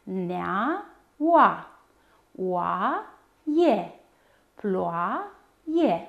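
slow syllables with a gap after each; despărțit în silabe. Shown as a demonstration. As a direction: draw out the first syllable, then pause. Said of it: "diftong într-o silabă". (rostit rar, pe silabe)

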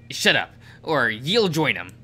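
loud irritated snap; angry. Acted. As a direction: bark it back, annoyed. Snapping back at teasing.

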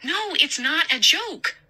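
high, slow insistent denial; frightened insistence. Acted. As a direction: plead that it's real. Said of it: "Nina cried".

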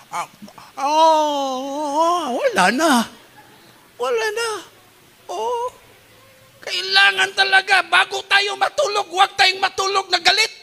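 dying man's regret. Retold or imitated. Preacher acting the stroke victim pleading to the congregation, tearful and high. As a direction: wail it like a man who can't speak anymore.

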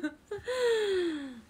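high laugh trailing down; falling laugh. Real, not acted. Amused by a friend's retort, laugh sinking down. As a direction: let the laugh fall away. Laughing at being told angry.

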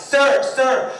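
high, insistent retold call; calling after someone. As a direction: try to get a passerby's attention. Voices young man calling after him.

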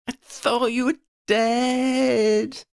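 high, drawn-out wail; long, high-pitched distressed wail. A narrator's reading. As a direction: wail it slowly at the top of the range. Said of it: "Freddie wailed".

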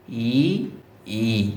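"ই" held long, slowly sounded; isolated long demo of "ই"; careful model pronunciation. Shown as a demonstration. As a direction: stretch each "ই" deliberately for learners to copy. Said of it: "এই উচ্চারণটি একটু দেখে রাখুন".

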